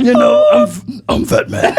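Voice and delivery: high, breaking into laughter as the cousin; high squeaky cousin voice. Retold or imitated. Retelling the cousin to friends, high laughing mimicry. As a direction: squeak out Vatman while laughing. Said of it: "He did the voice".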